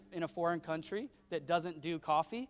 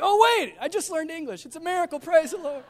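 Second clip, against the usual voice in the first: very high, hammed-up shopkeeper excitement; mock amazement; 12 semitones higher, 11 dB louder than usual. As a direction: exclaim it like a faked miracle. Mocks shopkeeper suddenly speaking English.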